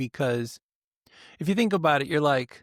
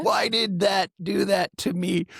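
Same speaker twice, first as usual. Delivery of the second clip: high-pitched, put-on protest; hammed-up plaintive whine; mock victim's complaint; 4 semitones higher than usual. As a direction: play the wronged party, higher voice. Meme victim blaming others, acted.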